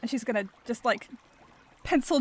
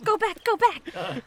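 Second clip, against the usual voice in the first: urgent; 9 semitones higher, 3 dB louder, slower than usual. Acted. Urging turtle to return.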